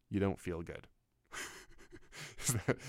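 normal talking voice

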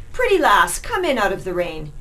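a cocky rat mocking the veiled lass; leering street rat. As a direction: tease her from the doorway.

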